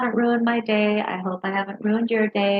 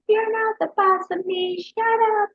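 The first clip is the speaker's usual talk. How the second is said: little sister fighting back; 11 semitones higher than usual. Retold or imitated. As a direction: imitate the kid snapping back at her sister. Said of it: "the eight-year-old starts fighting back".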